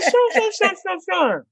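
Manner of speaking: very high, rushed eager voice; mock him hurrying to please; squeaky obliging boyfriend. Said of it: "He's like".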